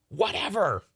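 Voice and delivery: high, exasperated squawk. Acted. Onlooker corrected about the saber, exasperated.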